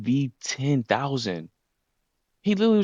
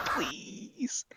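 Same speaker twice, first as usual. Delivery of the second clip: whispered please; 10 dB quieter than usual. Retold or imitated. Handcuffed pair begging Chopper.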